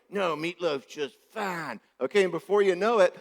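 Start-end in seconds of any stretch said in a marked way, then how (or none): 0.1-1.8 put-on slow, higher sulking little boy; sulky reply. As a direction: drag it out like a pouting kid. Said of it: "And he's like".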